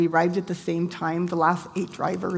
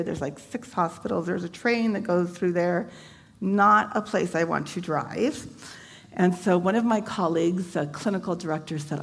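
normal talking voice

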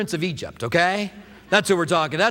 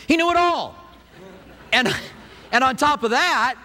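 high, louder punch on the whole line; emphatic preaching; 6 semitones higher, 3 dB louder than usual. Real, not acted. Preacher to congregation about Moses' learning, emphatic high call. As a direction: ring it out, he knew it ALL.

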